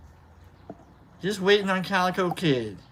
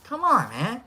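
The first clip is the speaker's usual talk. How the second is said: teasing comeback; 8 semitones higher than usual. Retold or imitated. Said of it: "I'm like".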